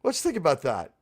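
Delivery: ordinary talk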